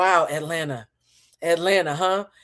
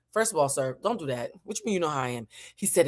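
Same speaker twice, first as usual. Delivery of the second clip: normal talking voice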